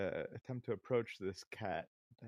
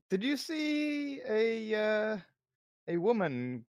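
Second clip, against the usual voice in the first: urgent plea; 10 semitones higher, 6 dB louder, slower than usual. Acted. Player character pleading.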